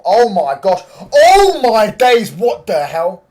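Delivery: very loud, high scream with drawn-out words; stunned excited shouting. Real, not acted. Streamer reacting to Oscar Bob live, screaming in drawn-out amazement. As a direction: yell it in total astonishment.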